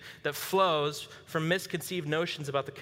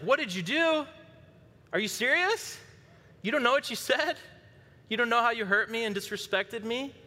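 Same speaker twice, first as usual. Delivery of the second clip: much higher, indignant mock-wife voice; upset wife; 8 semitones higher than usual. Wife confronts the husband who asks what he did, high indignant voice. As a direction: imitate an offended wife, pitched way up. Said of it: "she seems upset and off".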